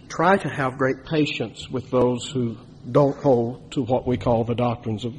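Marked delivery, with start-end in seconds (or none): 0.1-3.7 "try" leaned on, held slow and soft; stress on try. Real, not acted. Preacher admitting his limits, stress on "try". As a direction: put the weight on "try". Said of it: "emphasis on the word try".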